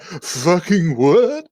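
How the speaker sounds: very slow, high-pitched startled reaction; mock shock. Marin is standing there as the door opens, acted shock. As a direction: stretch out the acted disbelief, rising at the end. Acting character startled by girl's arrival.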